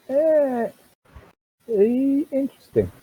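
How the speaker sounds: high-pitched musing noises, with pauses; intrigued shopkeeper. Acted. Shopkeeper flipping through the book, intrigued. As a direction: muse aloud in a high character voice.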